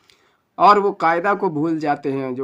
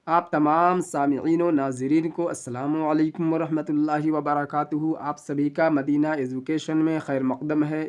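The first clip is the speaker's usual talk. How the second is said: normal talking voice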